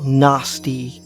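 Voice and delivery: usual speaking voice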